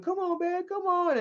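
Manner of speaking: high, soft crooning come-on; hammed-up pleading dad; mock seductive dad. Dad's come-on voiced in story.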